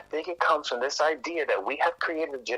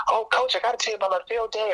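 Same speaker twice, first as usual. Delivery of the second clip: put-on higher, fast, eager child's voice; excited kid; 8 semitones higher, faster than usual. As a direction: gush like an excited little girl. Excited kid telling coach.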